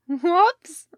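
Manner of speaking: loud, high-pitched "whoops"; too-loud drunken whoops. A narrator's reading. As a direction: blurt it out far too loudly. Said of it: "much too loud".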